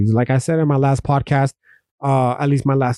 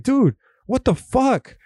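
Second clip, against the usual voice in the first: higher, slow, drawn-out what the fuck; exasperated disbelief; 8 semitones higher, slower than usual. Retold or imitated. Asking himself why junkies live on while his friend died, slow exasperated disbelief. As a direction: stretch it out, baffled and fed up. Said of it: "I ask myself, like".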